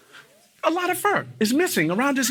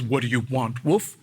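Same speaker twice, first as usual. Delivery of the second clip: low, softer dog reply; a hint of a low-voiced dog; lightly voiced dog; 10 semitones lower, 5 dB quieter than usual. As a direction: answer quietly and low as the dog. Story dog answers the wolf.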